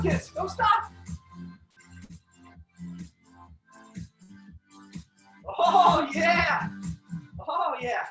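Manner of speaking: sharp martial arts commands. Real, not acted. Coach cheering kids through drill.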